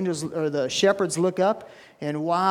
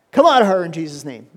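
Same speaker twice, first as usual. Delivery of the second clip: commanding; 8 dB louder, faster than usual. Retold or imitated. Paul casting out demon.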